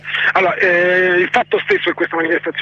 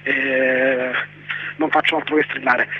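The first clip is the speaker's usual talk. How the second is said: real tired, low voice; low, spent voice after constant shouting; voice worn low from shouting; 4 semitones lower, 5 dB quieter than usual. Real, not acted. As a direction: apologise with a voice worn down by yelling. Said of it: "ho la voce molto bassa".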